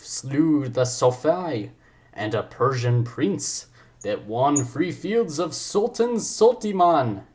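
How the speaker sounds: slow, swooping put-on accent; stretched-out, exaggerated theatrical accent; the Ryan accent. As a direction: declaim grandly in a hammed-up accent. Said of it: "gonna go for the Ryan accent".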